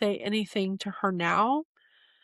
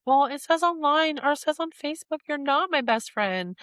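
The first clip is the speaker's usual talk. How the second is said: upset mom; 8 semitones higher than usual. Retold or imitated. Grieving daughter imitating her upset mom over the Facebook quiz, high and rising. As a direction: play the anxious mother pleading her case. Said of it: "she's like".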